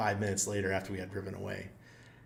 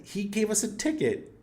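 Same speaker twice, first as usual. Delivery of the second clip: indignant report; 11 semitones higher than usual. Retold or imitated. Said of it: "I said".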